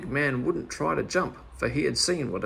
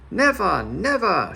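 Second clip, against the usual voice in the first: louder, higher defiant cry; valiant shout; 5 semitones higher, 5 dB louder, slower than usual. Acted. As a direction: shout it back bravely. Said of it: "shouted back Meddle valiantly".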